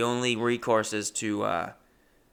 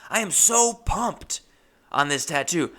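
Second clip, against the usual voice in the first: panicked overeager reassurance; 9 semitones higher, 9 dB louder than usual. Retold or imitated. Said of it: "I go".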